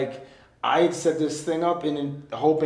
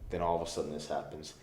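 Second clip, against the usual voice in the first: ordinary talk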